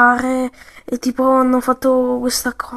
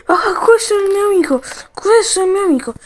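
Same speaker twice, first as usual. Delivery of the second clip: excited discovery; 8 semitones higher, 4 dB louder, faster than usual. Pretend. Toy finds his friend.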